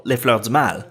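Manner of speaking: book title in native French pronunciation; French accent. Real, not acted. Host names the book to viewers, slipping into French accent. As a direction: slip into real French for the title. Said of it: "Again, my French accent".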